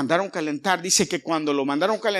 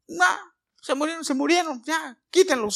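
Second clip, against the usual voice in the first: much higher, careless royal brush-off; king shrugging off the deaths; dismissive king; 12 semitones higher than usual. Retold or imitated. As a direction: wave them away like a bored king.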